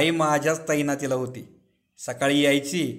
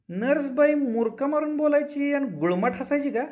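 much higher-pitched, prying question; eager curiosity; 10 semitones higher than usual. A narrator's reading. Janubhau asks Aba about the nurse, eagerly curious. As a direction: ask it high and keenly curious.

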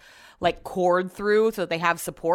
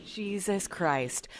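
slow, soft exasperated groan; real weary exasperation; 6 dB quieter, much slower than usual. Real, not acted. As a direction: drag it out, fed up. Exasperated at noise during recording.

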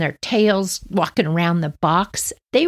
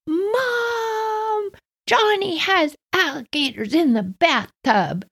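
much higher calling-out tattle; childish yell; 13 semitones higher, slower than usual. Retold or imitated. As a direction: yell for Mom like a tattling girl. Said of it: "I started yelling".

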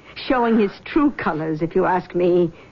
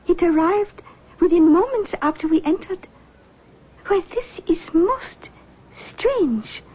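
lightly voiced mystic, higher pitch; seance medium; 7 semitones higher than usual. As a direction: greet them like a gracious clairvoyant. Medium senses spirit.

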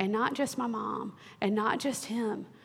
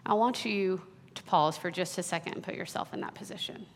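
ordinary delivery

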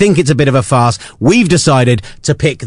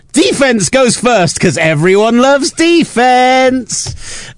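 announcer voice; 11 semitones higher, much slower than usual. Pretend. Grand announcement.

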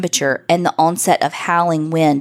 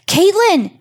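much higher, louder shriek of a name; horrified scream; 5 dB louder than usual. A narrator's reading. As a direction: shriek her name in pure horror. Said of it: "she cried out in horror".

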